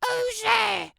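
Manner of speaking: a high, prim granny calling through the door; old granny voice. Acted. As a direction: call out like a frail grandma.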